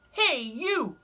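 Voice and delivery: high, loud, grumpy bug; grouchy ladybug voice. Acted. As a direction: bark the challenge up high. Ladybug challenges elephant.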